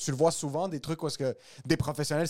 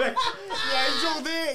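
laughing; 11 semitones higher, 4 dB louder, much slower than usual. Real, not acted. Laughing while starting a story.

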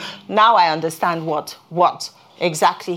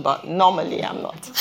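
normal talking voice

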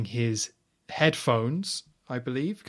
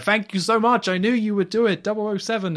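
much higher and louder, overjoyed thanks; gushing general; 9 semitones higher, 7 dB louder than usual. Retold or imitated. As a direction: throw your arms out in delighted thanks. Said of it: "Kuskov goes up to Bond, is like".

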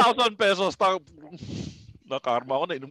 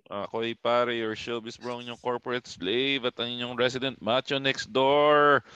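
mock host intro; 7 semitones lower, 3 dB quieter than usual. Host introduces himself on show.

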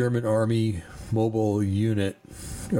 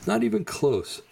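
scoff; 10 semitones higher than usual. Real, not acted. Host scoffing after a laugh.

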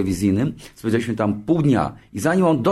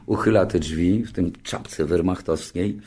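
ordinary talk

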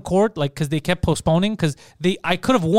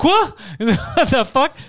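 a sudden high, loud "What?" then a slow "What the fuck"; shocked cop losing it in court; outraged disbelief; 6 semitones higher, 3 dB louder, much slower than usual. Retold or imitated. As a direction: play the cop blowing up in shock. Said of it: "the police officer lost his shit".

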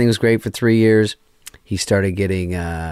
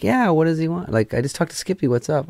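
higher-pitched imitation of a puzzled friend; 9 semitones higher than usual. Friend asking about the assistant's texts, imitated puzzlement. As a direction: voice her higher, lightly baffled.

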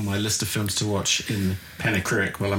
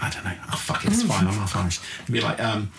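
ordinary talk

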